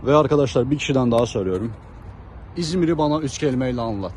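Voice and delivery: ordinary talk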